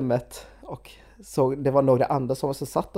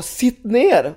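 higher, louder mock bark of a border officer; retelling the officer who roared at them, mock bark; 8 semitones higher, 5 dB louder than usual. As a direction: bark the order like an angry guard. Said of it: "röt åt oss".

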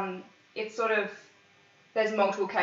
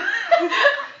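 high laugh; 10 dB louder than usual. Real, not acted.

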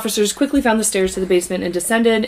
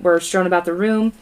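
normal talking voice

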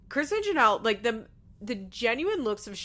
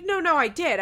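louder, higher protest; mock defensive insistence; 8 semitones higher, 9 dB louder than usual. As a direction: act out a quick, emphatic denial. Acted defensive husband in retold quarrel.

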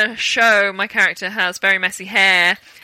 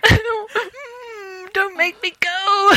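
high, slow, whiny plea; hammed-up tearful whining; mock whine; 11 semitones higher, much slower than usual. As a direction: whimper it like a child begging to stay. Doesn't want to go home.

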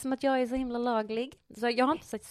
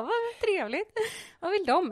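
högt och ljust förtjust; låta glatt ovetande; naivt glad; 10 semitones higher, 4 dB louder than usual. Retold or imitated. (ljust och naivt förtjust)

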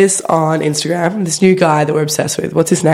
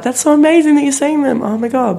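gushing relative; 9 semitones higher than usual. Retold or imitated. Family member gushing again.

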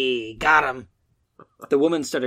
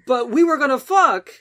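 higher, louder pleading whine; deflated whine; 12 semitones higher, 7 dB louder than usual. Acted. Matt protesting to the boogeyman, deflated whine. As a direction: whine as the hope drains out. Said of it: "he said, deflating".

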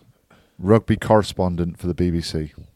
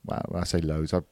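usual speaking voice

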